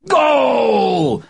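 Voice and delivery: loud high excited yell; goal shout. A narrator's reading. Daddy shouts at TV.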